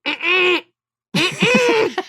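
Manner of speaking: high, closed-mouth muffled squeal; muffled protest. Acted. Felicity protesting in the background, high muffled squeals. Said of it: "You hear Felicity be like".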